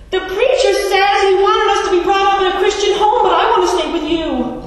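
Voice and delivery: put-on high, loud child's wail of protest; upset little boy. Five-year-old to parents after baptism, loud upset protest. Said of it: "the little boy says this".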